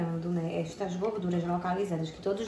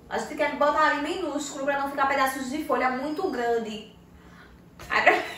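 wired, fast and high; too much caffeine; 7 semitones higher, 5 dB louder than usual. Real, not acted. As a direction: hyper from strong coffee. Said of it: "sabe por que eu tô elétrica hoje? Porque eu tomei café".

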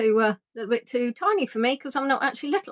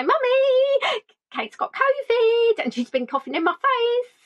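put-on high, slow little-girl plea; whiny child; 12 semitones higher, slower than usual. Daughter calls her mother for sympathy in a childish whine. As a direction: call to mummy like a needy child.

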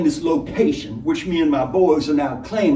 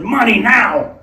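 higher, louder barked demand; shouted; 8 semitones higher, 7 dB louder, slower than usual. Acted. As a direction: yell it as the gun fires. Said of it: "the robber shouted".

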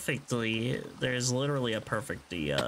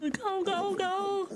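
high squeaky go go go; high-pitched hurry-up chant; excited urging; slower than usual. Real, not acted. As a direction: cheer it on in a tiny high voice. Urging on the TNT blast.

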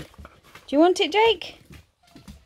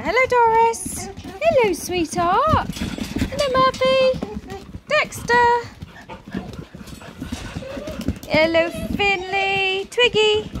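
greeting the puppies; 7 semitones higher than usual. Real, not acted. Trainer greeting each of her puppies, high and bright. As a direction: sing each name out high to the pups.

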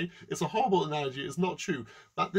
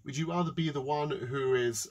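ordinary talk

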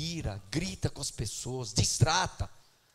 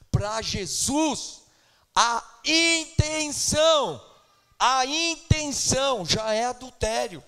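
loud, drawn-out intenção, higher; fiery preaching; 9 semitones higher, 8 dB louder, slower than usual. Acted. Preacher warning the church that intent is already adultery, loud and fiery. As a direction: preach it out with full force.

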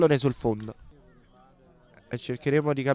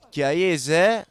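normal talking voice

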